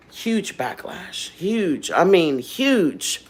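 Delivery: huge drawn out slowly; slow, heavy stress on huge; emphatic huge. Real, not acted. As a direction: stretch out huge each time. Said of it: "repeating huge for emphasis".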